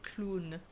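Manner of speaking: "clown" said the French way, as "kloon"; French pronunciation. Shown as a demonstration. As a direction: say "clown" with a long oo, French style. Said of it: "how we say clowns in French".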